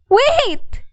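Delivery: high, loud "Wait!"; crying out. A narrator's reading. Camilla cries out to stop the old woman leaving, high and loud. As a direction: call her back urgently. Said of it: "she cried".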